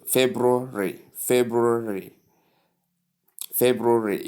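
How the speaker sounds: "February" as FEB-roo-ree; correct pronunciation. Shown as a demonstration. Teacher models February for learners, correct pronunciation with R. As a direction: sound the first R in "February", right after the B. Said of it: "You don't pronounce it omitting the R".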